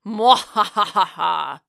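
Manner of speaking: slow evil cackle; villainous gloating laugh; mad scientist. Acted. Gloating that mom can't stop her.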